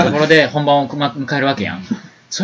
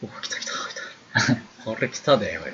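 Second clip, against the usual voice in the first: hushed alarm; 3 semitones lower, 8 dB quieter than usual. Retold or imitated. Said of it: "sees the girl in the doorway".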